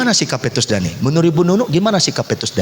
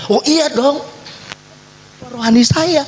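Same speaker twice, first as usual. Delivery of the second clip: proud defender; 12 semitones higher than usual. Acted. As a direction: answer proudly, high and bright. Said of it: "kenapa kok kamu bela".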